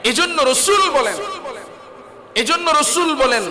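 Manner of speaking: high, forceful preaching call; preaching shout. Real, not acted. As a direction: call it out to the congregation. Preacher raises voice to crowd.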